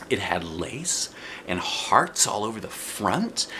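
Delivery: ordinary delivery, nothing marked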